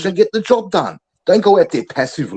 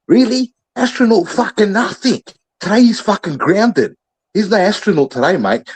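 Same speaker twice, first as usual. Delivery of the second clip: scoffing coach; 6 semitones higher than usual. Acted. Mocking player's nickname as coach.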